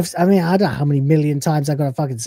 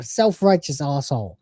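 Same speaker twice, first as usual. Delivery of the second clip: ordinary delivery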